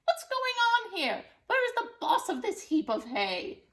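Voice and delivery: loud, high demand; furious witch. Acted. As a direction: bark at the chicken.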